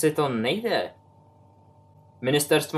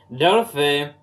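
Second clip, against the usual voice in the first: louder call across the house; 6 dB louder than usual. A narrator's reading. Uncle calls his niece from the next room, loud. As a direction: call her name from afar.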